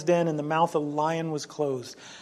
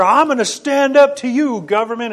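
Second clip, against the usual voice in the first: higher and louder, puffed-up rebel; mock defiance; 7 semitones higher, 9 dB louder than usual. An imagined rebel defying the government, loud and higher. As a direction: play a brash rebel squaring up. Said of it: "it's never".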